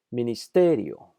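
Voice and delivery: Ministerio slowly, stressing "te"; emphasized stressed syllable. Shown as a demonstration. Teacher demonstrating prosodic accent, slow Ministerio stressed on "te". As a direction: draw out and punch the "te" in Ministerio. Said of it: "Note que hay un énfasis de pronunciación".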